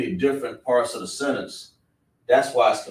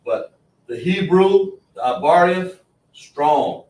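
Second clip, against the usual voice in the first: louder, higher, slow proud proclamation; proud preaching; 6 semitones higher, 7 dB louder, slower than usual. Real, not acted. Preacher extolling Hebrew to the class, proud and loud. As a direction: lift it up like a proclamation.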